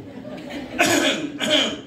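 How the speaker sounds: pointed throat-clearing; imitated cough. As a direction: fake a conspicuous cough at the preacher. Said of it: "dia batuk".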